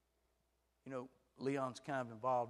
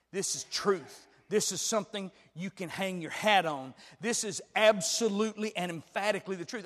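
louder, punching each claim; rising preacher's conviction; emphatic preaching; 5 semitones higher, 10 dB louder than usual. Acted. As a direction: insist this is the plain truth.

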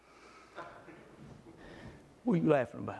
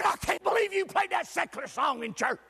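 higher, scolding church lady; indignant church lady; 7 semitones higher than usual. Retold or imitated. Church lady confronting the pastor after service, indignant. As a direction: wag a finger at the preacher.

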